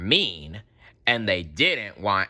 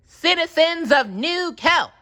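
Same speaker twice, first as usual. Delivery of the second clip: high, louder official address; public announcement; 5 dB louder than usual. A narrator's reading. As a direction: speak to the whole city. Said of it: "announced SpongeBob over a microphone".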